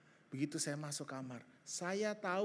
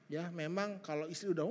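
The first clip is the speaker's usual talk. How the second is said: usual speaking voice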